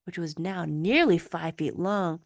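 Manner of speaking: ordinary delivery, nothing marked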